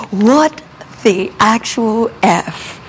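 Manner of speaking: slow, emphatic retold exasperation; mock outrage, punched first word; internal tantrum. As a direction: draw out each word in disbelief. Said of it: "full-blown having this internal tantrum".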